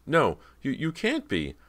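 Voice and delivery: high, faltering disbelief; trembling cry. A narrator's reading. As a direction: stammer it out in horror, fading in force. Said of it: "cried in a trembling, weak voice".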